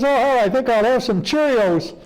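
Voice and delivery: put-on high-pitched little-kid voice; child's high, eager answer; six-year-old boy. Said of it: "the mother turned to the six-year-old".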